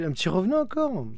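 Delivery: delighted by another ghost; excited. Real, not acted.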